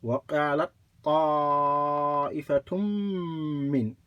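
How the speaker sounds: recited, doubled ط with long vowel, held M into "مِّنْ"; joined shaddas. Shown as a demonstration. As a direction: run "وَقَالَتْ طَائِفَةٌ مِّنْ" together, lengthening "طَا" and the M. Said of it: "The shadda on the ta is non-original".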